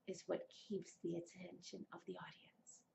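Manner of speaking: hushed whisper. Shown as a demonstration. Showing viewers soft reading, whispered. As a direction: drop to a breathy whisper to hold them. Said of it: "speaking softly".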